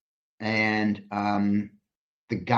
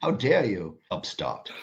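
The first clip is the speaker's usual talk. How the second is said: posh British, the last word dropped to a breathy hush; haughty English aristocrat put-down, hammed up; posh British accent scolding an upstart; mock King Charles accent. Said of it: "that your best King Charles accent?".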